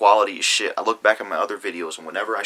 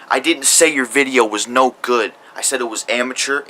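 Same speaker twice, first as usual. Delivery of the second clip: angry; 3 semitones higher, 8 dB louder than usual. Real, not acted. Rebutting a YouTuber's accusation.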